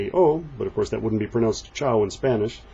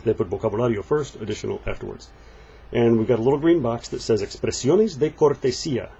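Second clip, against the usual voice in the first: normal talking voice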